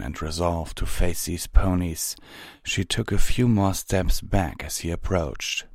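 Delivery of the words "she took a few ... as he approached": ordinary delivery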